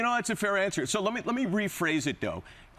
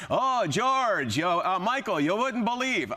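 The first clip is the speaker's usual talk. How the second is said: hammed-up Yiddish accent, swooping higher; mock Yiddish accent; 5 semitones higher, slower than usual. Shown as a demonstration. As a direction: put on a caricatured Yiddish accent. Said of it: "I'm Yiddish".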